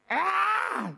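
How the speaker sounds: sudden high mock shriek; playful yelp of mock alarm; imitated shriek. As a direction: let out a short, high pretend scream. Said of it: "that sounded like a shriek".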